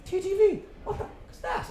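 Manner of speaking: high, baffled outburst; hammed-up disbelief at a silly name; incredulous Roy Keane. Roy Keane mocks the show's name, high and incredulous. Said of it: "he looked at me and went".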